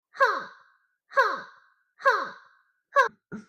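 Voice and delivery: short, high "huh" efforts; high attack grunt. Shown as a demonstration. Streamer testing attack noises, short high grunts. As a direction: puff out quick high fighting grunts. Trying game character attack sounds.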